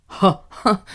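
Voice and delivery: a touch of obliging mock laughter; lightly faked agreeing laugh; dutiful echo. A narrator's reading. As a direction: echo him out of duty. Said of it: "kontráztam kötelességtudóan".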